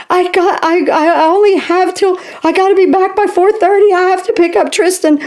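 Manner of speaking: a hint of tearful panic over the time; upset pleading. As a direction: replay the frantic panic in the car. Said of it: "I'm crying".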